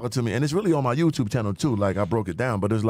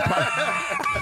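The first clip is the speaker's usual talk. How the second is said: laughing squeak. Real, not acted.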